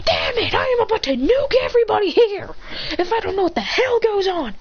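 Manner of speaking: high-pitched comic ranting; exasperated threat. Acted. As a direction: rant high, at wits' end. Exasperated threat in acted quarrel.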